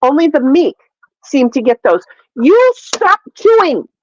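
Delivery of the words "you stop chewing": high, drawn-out telling-off; real stern aside to a pet; scolding the pet. Real, not acted. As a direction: scold the cat slowly in a raised pitch. Scolding the cat for chewing.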